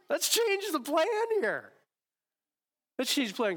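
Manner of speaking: high mock whine; mock whiny bargaining. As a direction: whine like a kid trying to change the rules.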